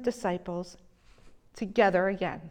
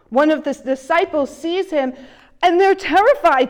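louder, higher, building alarm; rising alarm; 5 semitones higher, 9 dB louder than usual. Acted. Preacher to congregation, describing disciples seeing a figure, alarmed. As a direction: tell it like the panic is rising.

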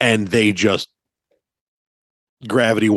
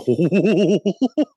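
high rising giggle; a squeaky laugh; 9 semitones higher than usual. Real, not acted. Laughing at the whale tail memory, high giggle.

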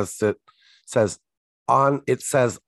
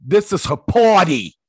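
put-on thick Texas accent; 9 semitones higher, 7 dB louder than usual. Co-host mocks Joe's thick Texas accent, louder. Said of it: "laying the accent on pretty thick".

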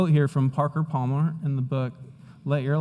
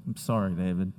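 ordinary talk, nothing marked

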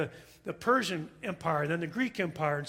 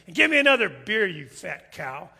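rude talking duck; 8 dB louder than usual. Acted. Duck orders another beer from stewardess, loud and rude. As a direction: demand it loudly, then drag out the jab. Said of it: "the duck chimes in and says".

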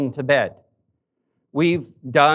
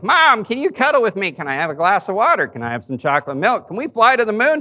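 higher, louder mock kid voice, rattling off requests; imitating a little boy pleading for one more thing; little boy begging; 7 semitones higher, 4 dB louder, faster than usual. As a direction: play the whiny son stalling bedtime. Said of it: "And he's like".

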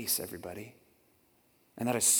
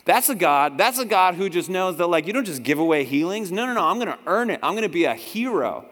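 proud boast; 4 semitones higher, 7 dB louder than usual. Acted. Naaman voiced, proud.

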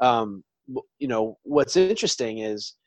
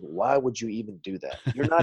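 retold Southern drawl, a bit lower and softer; 3 semitones lower, 4 dB quieter than usual. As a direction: lightly imitate a Southern drawl. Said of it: "And his Southern drawl is like".

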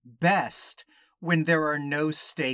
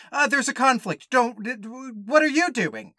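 high, loud, flustered fake argument; mock lovers' quarrel; 8 semitones higher, 5 dB louder than usual. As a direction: stammer through a hammed-up protest. Acts out contrived lovers' quarrel.

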